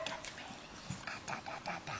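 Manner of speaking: soft whisper. Real, not acted. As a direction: hush it to the pups. Whispering to puppies.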